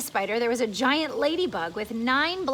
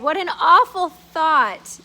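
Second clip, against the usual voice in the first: amused horror; 5 semitones higher, 10 dB louder, slower than usual. Acted. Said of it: "they said".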